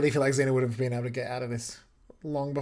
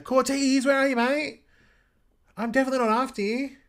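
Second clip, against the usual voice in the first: a much higher, hammed-up line reading; bad line reading; 9 semitones higher, 3 dB louder than usual. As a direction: overact the line like a bad actor. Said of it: "that was pretty bad line reading".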